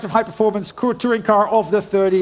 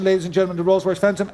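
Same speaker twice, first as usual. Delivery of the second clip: ordinary talk, nothing marked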